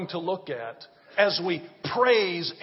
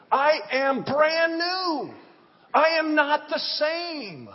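high, slow, ringing sermon cadence; preaching at full force; 5 semitones higher, slower than usual. Acted. Pastor preaching to the congregation, high and slow. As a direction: lift each line slowly to the rafters.

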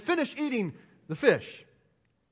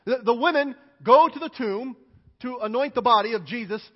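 ordinary talk, nothing marked

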